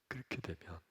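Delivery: ordinary talk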